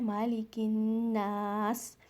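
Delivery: मालिकिन्नास drawn out, nasal न; slow recitation. Shown as a demonstration. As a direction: stretch मालिकिन्नास. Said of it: "आपने गुन्ना करना है".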